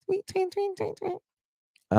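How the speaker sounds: tiny, very high-pitched tweets; bird chirping imitation. Imitates birds chirping for a listener.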